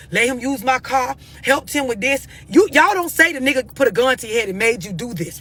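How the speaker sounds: normal talking voice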